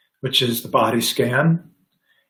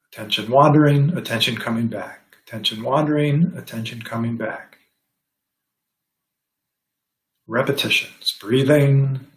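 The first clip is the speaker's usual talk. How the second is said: guided meditation. Acted. Teacher guiding the class into mindful breathing, calm meditation voice. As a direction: ease them into the breath gently.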